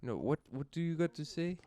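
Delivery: put-on soft, halting European-accented English; mock European accent. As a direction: imitate a European fumbling through English. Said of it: "like a European trying to speak English".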